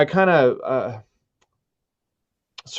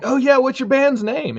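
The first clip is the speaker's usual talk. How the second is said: bright, much higher eager question; mock eager question; 4 dB louder than usual. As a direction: sound keen on the offer. Retold eager reply to Joe.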